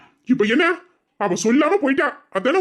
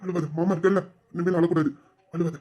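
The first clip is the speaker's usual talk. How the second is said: soft, low comforting murmur; soothing a child; 6 semitones lower, 6 dB quieter than usual. Acted. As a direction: hush a crying little girl gently. Uncle consoling crying child.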